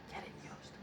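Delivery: whispered aside; teacher whispering a money tip. Acted. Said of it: "whispered aside".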